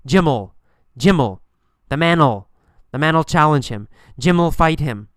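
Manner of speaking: "Jim'll" with will reduced to an "ol" sound; demonstrating "Jim'll" as one joined word; contracted will. Shown as a demonstration. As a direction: run "ol" onto the subject in "Jim'll", "man'll". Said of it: "We say ol instead of will".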